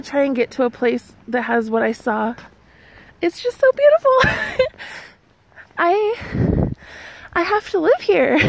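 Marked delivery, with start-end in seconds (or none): 3.2-5.8 higher, teary overwhelmed voice; crying through the words; choked-up, crying with joy; tearful. Real, not acted. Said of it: "I'm crying again". 5.8-8.4 higher, teary overwhelmed voice; crying through the words; choked-up, crying with joy; tearful. Real, not acted. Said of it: "I'm crying again".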